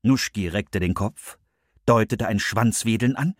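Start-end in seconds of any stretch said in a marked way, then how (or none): none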